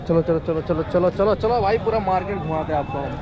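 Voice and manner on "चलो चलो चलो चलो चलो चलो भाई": normal talking voice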